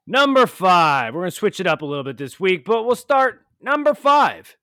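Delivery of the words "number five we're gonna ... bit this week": ordinary delivery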